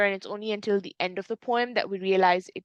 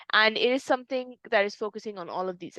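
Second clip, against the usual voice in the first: usual speaking voice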